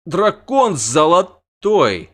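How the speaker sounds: loud slow marvel; loud drawn-out awe; awed shout. A narrator's reading. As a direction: proclaim the sight in awe. Bogold answers in awe.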